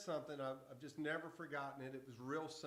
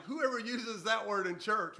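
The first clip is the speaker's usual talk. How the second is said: shocked disbelief; 7 semitones higher, 12 dB louder than usual. Retold or imitated. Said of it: "I'm like".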